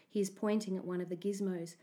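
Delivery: usual speaking voice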